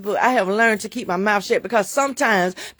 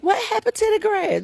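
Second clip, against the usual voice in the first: much higher, fake-shocked question; mock surprised voice; 11 semitones higher than usual. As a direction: squeak it out in mock disbelief. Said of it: "we was like".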